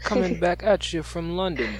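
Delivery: put-on playful stab at a London accent that drifts toward Australian; mock British accent. Co-host greeting listeners in a mock London accent. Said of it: "sounded like I was from Australia".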